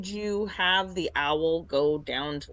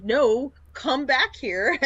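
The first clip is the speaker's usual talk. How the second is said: mock calling out; 6 semitones higher, 4 dB louder, slower than usual. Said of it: "I was like".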